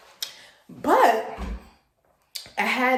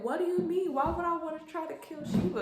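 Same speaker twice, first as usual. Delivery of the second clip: put-on higher pleading child's protest; innocent child; 9 semitones higher than usual. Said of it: "I was like".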